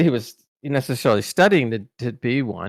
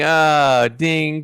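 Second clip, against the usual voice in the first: louder, lightly acted lightbulb moment; sudden realization; 4 semitones higher, 4 dB louder than usual. Actor realizing why co-star got nice, dawning realization. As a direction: let the penny drop out loud.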